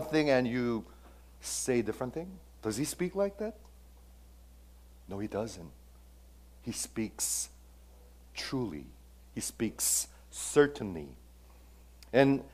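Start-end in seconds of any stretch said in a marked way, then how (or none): none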